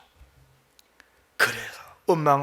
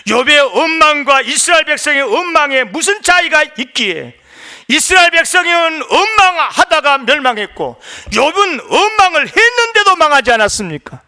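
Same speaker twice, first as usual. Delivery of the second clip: high, loud, impassioned pulpit delivery; full-force preaching; 10 semitones higher, 7 dB louder than usual. Acted. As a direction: preach it forcefully at the top of your range.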